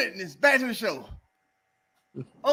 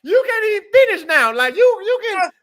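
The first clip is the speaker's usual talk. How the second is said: high, laughing teasing; 7 semitones higher, 4 dB louder than usual. Real, not acted. Laughing after joke.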